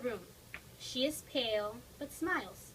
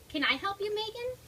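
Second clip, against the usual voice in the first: put-on little girl voice; 8 semitones higher than usual. Child Katie asks sister.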